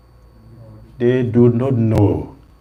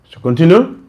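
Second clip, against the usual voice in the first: repeated louder and higher, rising; 6 semitones higher, 8 dB louder than usual. Real, not acted. Speaker asking the audience again, louder and higher. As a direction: ask again louder when nobody answers.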